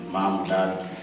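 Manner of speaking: ordinary talk, nothing marked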